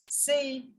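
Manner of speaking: C said as "say"; French letter C. Shown as a demonstration. Teacher explaining the French alphabet, C as "say". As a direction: give C its French name, "say". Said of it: "we pronounce this alphabet as".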